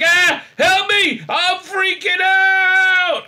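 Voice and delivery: shrieking mock plea for help; long, loud, very high panicked wail; Saru. Saru begging the crew for help, hammed-up panic. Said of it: "he starts to freak out".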